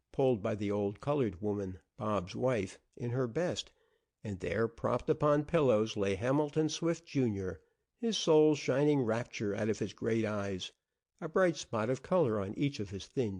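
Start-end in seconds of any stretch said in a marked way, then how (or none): none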